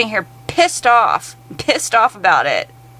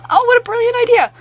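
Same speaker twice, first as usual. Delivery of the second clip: put-on much higher, gushing valley-girl parody; mock gushing do-gooder; 11 semitones higher than usual. Vlogger parodies gushing Bellingham residents, high mocking squeal. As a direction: coo over the cute tiny homes like a smug local. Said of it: "people sitting here saying".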